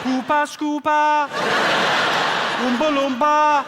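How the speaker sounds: high, loud, drawn-out Geordie; hammed-up Newcastle accent. Shown as a demonstration. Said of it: "if you're trying to do the Geordie accent".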